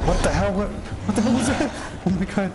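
high-pitched shocked outburst; startled. Real, not acted. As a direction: yelp it out in alarm. Startled by something in the game.

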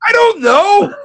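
high, loud panicked whoas; frantic high-pitched protest in pain; panicked yelling. Retold or imitated. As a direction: yell out in pain and alarm at the doctor.

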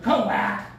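ordinary talk, nothing marked

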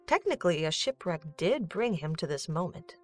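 normal talking voice

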